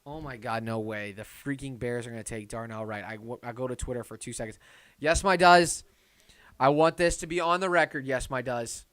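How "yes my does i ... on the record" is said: loud concession. Real, not acted. Admits chat was right.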